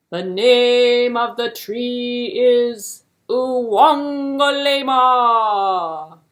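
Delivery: slow, loud, regal king's voice; booming king. Acted. King grandly telling hare the tree's name, loud and slow. King answers third time.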